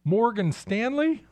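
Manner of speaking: can't believe it's on the list; incredulous. Real, not acted. Listing non-industrial Dow stocks.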